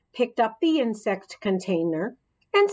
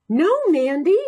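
warning call; 8 semitones higher, 6 dB louder than usual. A narrator's reading. Teacher calls out to stop.

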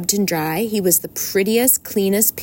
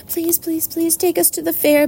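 high pleading little gosling voice; begging gosling; 10 semitones higher than usual. Acted. A gosling begging its mother to go. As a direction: beg like a tiny gosling. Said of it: "begged a gosling".

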